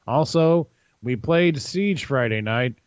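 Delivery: normal talking voice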